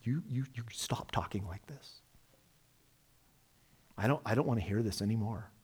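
urgent whisper, then quick and hushed; whispered rebuke. Retold or imitated. Peter scolding Jesus in a private whisper. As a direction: hiss it privately so nobody hears. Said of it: "he begins to rebuke him".

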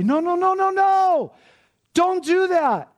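high, loud, desperate no, no, no; a missing voice crying out to stop it; anguished protest. Acted. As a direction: plead at the top of your voice. No one to cry out.

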